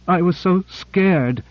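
sobbing. A narrator's reading. Cora, rescued and clinging to Grant, sobbing. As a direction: let the fear spill out in slow sobs. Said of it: "she sobbed".

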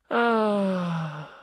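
a long blissed-out "ah"; contented sigh. A narrator's reading. Everybody sighing contentedly together, a long blissed-out ah. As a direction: sigh like warmth just came back. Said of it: "said everybody".

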